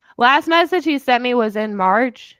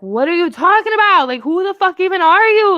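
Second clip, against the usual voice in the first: indignant retort; 8 semitones higher, 5 dB louder than usual. Retold or imitated. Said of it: "And I was like".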